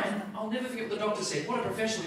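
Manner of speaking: ordinary delivery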